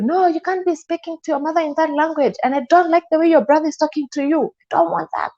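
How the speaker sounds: a hammed-up jealous boyfriend snapping at her; mock jealous rant. Retold boyfriend's jealous outburst.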